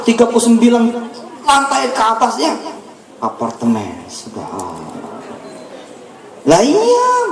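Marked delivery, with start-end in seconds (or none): 3.2-5.2 slow, much softer, lower awe; hushed awe. Real, not acted. Preacher marvelling at the mosque building, hushed slow awe. Awed exclamation after apartment reveal. 6.5-7.3 much higher emphatic exclaim; excited. Real, not acted. Preacher stressing the point to the crowd, high excited.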